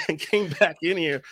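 laughing through the words. Real, not acted. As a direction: chuckle while saying it. Laughs at himself.

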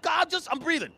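high, put-upon mock complaint; fed-up comic defence; exasperated protest. Exasperated reply to girlfriend.

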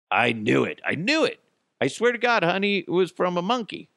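pleading spouse. Retold or imitated. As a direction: beg and swear it was the monkey. Acted spouse argument.

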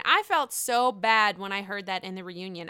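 usual speaking voice

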